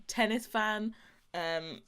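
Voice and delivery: usual speaking voice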